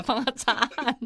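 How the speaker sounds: usual speaking voice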